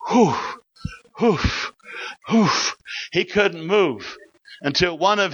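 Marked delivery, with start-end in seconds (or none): 0.1-2.5 short breathy puffs of "whew"; imitated whew. Officer floored by the Spirit, imitated dazed whew. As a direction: blow out dazed, helpless little whews. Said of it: "and he was saying".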